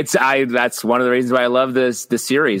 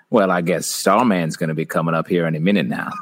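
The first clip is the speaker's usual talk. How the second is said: lower, gentle Southern lilt, laughing a little; delicate New Orleans accent; 6 semitones lower than usual. Pretend. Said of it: "a delicate".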